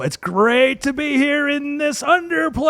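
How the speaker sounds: yelling to crowd. Acted. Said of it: "I yell".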